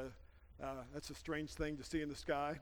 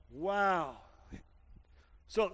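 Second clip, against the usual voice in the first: ordinary talk, nothing marked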